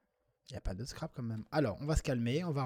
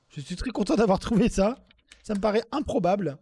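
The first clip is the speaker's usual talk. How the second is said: mock delight; 8 semitones higher, 11 dB louder than usual. Gloating over his word.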